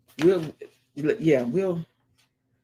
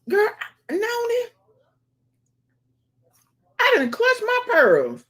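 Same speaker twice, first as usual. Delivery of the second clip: high, loud mock-scandalized cry; scandalized exclamation; 6 dB louder than usual. Real, not acted. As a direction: clutch your pearls out loud.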